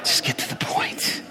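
whispered under the breath, impatient; muttering to himself while Debbie drags it out. Retold or imitated. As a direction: hush it like a private grumble. Impatient inner thought about Debbie.

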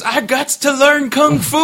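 high, loud, drawn-out hammed-up holler; losing his mind. Imitating a moviegoer running down the aisle, wildly excited yell. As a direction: holler it like an overexcited moviegoer gone wild. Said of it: "Like, losing his mind".

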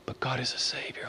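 whisper. Real, not acted. Preacher whispering to congregation that God saves. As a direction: let it slip out in a whisper.

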